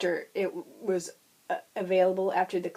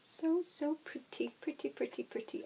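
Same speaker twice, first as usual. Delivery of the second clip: swooning; 7 semitones higher, 8 dB quieter than usual. Real, not acted. Swooning over yarn, fading.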